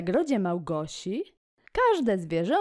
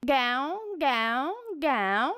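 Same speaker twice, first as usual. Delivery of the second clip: a slightly higher goose call; goose honking; 7 semitones higher, much slower than usual. Acted. Reading the poem to kids, a light goose honk. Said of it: "Gąska gęga".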